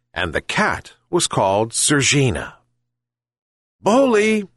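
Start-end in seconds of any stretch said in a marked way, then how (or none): 3.8-4.5 high calling shout to a dog. A narrator's reading. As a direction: call out to the dog, pitched high. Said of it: "shouted the old man to his dog".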